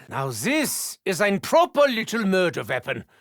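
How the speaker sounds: ordinary talk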